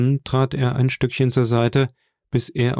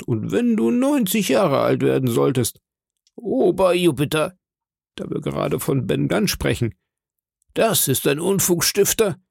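higher-pitched character voice, full of conviction; animated high doctor's voice; doctor marvelling; 6 semitones higher than usual. Acted. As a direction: praise the boy emphatically, pitched up. Narrator voices the doctor, moved.

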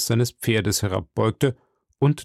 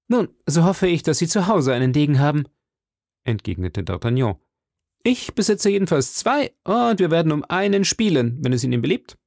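higher, heated challenge; calling out; 5 semitones higher than usual. Acted. D'Artagnan calls out to the Englishman, higher heated challenge. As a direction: fling the words at him.

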